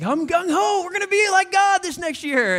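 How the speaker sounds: high, loud eager resolution; gung-ho. Retold or imitated. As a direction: play it overeager and pumped. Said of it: "so you're like".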